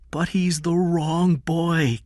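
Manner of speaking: slow, high woman's voice; protesting woman. A narrator's reading. Kathy reminds Eddie of the mistake, protesting. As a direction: plead in a drawn-out high pitch.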